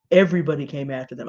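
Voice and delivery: normal talking voice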